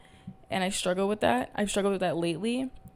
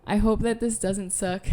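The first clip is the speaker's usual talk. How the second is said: usual speaking voice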